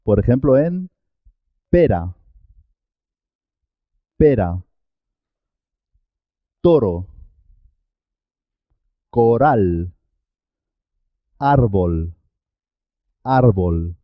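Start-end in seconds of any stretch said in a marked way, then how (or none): 1.7-9.9 one light tongue tap in "pera", "toro", "coral"; single tapped R. Shown as a demonstration. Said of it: "suena simple". 11.4-14.0 short R before the b in "árbol"; simple R. Shown as a demonstration. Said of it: "suena simple".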